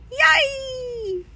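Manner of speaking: high scream of fright; a long, high, frightened scream; terrified scream. A narrator's reading. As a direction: scream out of a nightmare. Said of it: "leaped out of bed screaming".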